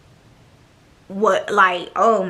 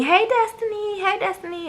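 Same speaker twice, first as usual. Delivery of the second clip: sugary imitated greeting; 10 semitones higher, 3 dB quieter than usual. Roommates greeting her, imitated.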